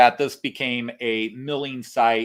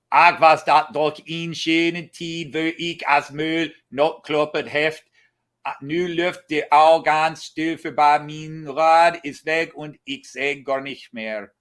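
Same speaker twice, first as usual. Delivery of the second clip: slow inscription reading; 4 dB louder than usual. Shown as a demonstration. Reading the old Low German inscription.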